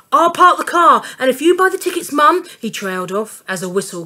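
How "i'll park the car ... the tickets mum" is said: high, loud and out of breath; panting. A narrator's reading. Dad panting at the kids to hurry. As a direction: gasp it out, rushing.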